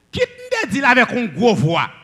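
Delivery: high, loud big voice. Shown as a demonstration. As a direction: say it in a big, forceful voice. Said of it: "avec une grosse voix". (gwo vwa)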